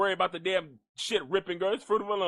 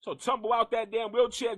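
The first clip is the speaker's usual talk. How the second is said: ordinary delivery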